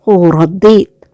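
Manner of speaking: ordinary delivery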